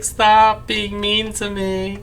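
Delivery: a hint of a timid pleading voice; voicing the sad dog. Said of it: "sad little wife that's just like".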